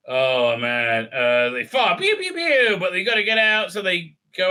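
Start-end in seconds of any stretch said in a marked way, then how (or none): 2.0-2.8 high pew pew blaster sounds; imitating the guns firing; mouth gunfire. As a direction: make laser noises.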